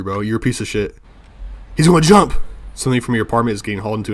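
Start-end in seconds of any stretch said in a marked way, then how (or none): 1.8-2.3 alarmed cry. Real, not acted. Alarmed at neighbor jumping.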